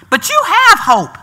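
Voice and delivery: loud high building preach; preaching at full force. Real, not acted. Preacher rallying the church, building full-force preaching.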